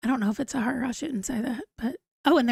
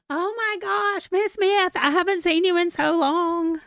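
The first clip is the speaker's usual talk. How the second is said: excited greeting; 6 semitones higher than usual. Real, not acted. Excited greeting of a chat viewer.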